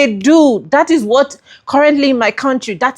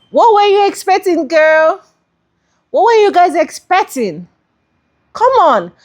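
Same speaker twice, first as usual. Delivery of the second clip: exasperated; 6 semitones higher than usual. Real, not acted. Scolding regretful voters.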